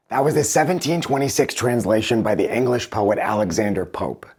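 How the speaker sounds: usual speaking voice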